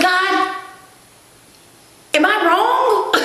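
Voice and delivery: high, slow worried question; pleading prayer. Retold or imitated. Asking God if she is wrong, high drawn-out plea. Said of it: "I said".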